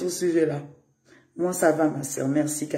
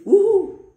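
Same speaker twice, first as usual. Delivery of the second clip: mock whoop; 13 semitones higher, 9 dB louder than usual. Mocking the games with lives.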